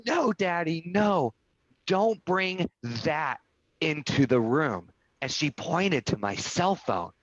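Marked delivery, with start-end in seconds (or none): 0.1-4.9 put-on higher-pitched child's plea, slowing down; lightly voiced four-year-old, drawing out the demand; emotional little daughter. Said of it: "she was so emotional".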